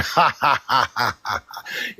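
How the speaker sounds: quiet chuckle; laughing. Real, not acted. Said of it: "So I just came up here".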